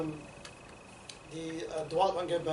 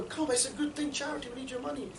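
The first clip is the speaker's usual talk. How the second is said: acted coaxing; 7 semitones higher than usual. Acts out recruiter persuading passer-by.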